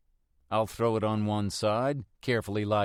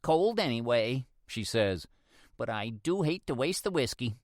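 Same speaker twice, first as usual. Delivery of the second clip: put-on wry old woman; 3 semitones higher than usual. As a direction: toss it off with a wink. Neighbour's wry quip.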